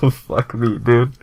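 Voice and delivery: usual speaking voice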